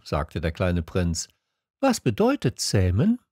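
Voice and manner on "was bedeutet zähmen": high, questioning prince voice; little prince. A narrator's reading. Little prince asking the fox, high questioning voice. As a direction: ask it as a small curious prince, pitched up.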